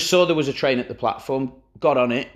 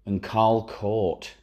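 ordinary talk, nothing marked

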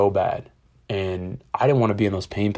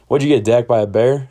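loud, teasing retold jibe; imitating an old guy ribbing someone; joshing stranger; 3 semitones higher, 7 dB louder than usual. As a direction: toss it out as a loud wisecrack. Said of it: "just wanted to bullshit".

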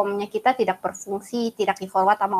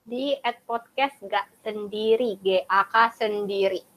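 slow handle read; slow and deliberate letter by letter; slow, clear spelling out; 7 dB quieter, slower than usual. Shown as a demonstration. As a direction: spell the name out carefully. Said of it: "spelling out handle".

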